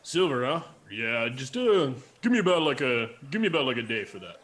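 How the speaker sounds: a busy craftsman quoting a deadline; blacksmith voice. Acted. Blacksmith answers about silver.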